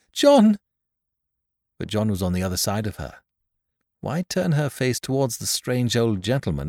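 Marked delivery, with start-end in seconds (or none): 0.1-0.6 sudden call. Acted. Wife calls out suddenly.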